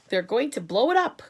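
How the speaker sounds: loud alarmed cry; सेनाध्यक्ष घबराकर ज़ोर से चिल्लाते हुए. Acted. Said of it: "cried the chief of the army".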